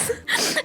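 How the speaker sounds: airy giggle, no voice; soft high laugh; high breathless giggle. Real, not acted. Laughing at her own story.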